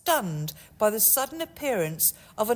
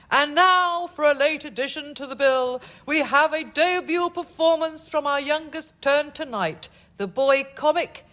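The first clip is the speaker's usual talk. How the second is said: loud, high showman's announcement; announcer; 6 semitones higher, 4 dB louder than usual. Acted. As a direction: bellow it like a music hall chairman. Music hall chairman announces act.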